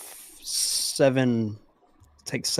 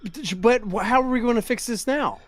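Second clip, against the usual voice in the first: panicked; 12 semitones higher, 4 dB louder than usual. Real, not acted. Panicked over lost leg.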